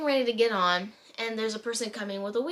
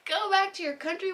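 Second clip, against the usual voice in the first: much higher, disbelieving repeat; incredulous; 11 semitones higher than usual. Retold or imitated. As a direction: echo the insult in disbelief. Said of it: "I was like, "What?".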